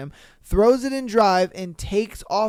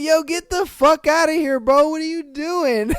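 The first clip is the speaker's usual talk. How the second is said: much higher, louder mock yell; retold yell; 8 semitones higher, 4 dB louder than usual. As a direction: bark them awake like an angry host. Homeowners yelling at the squatters.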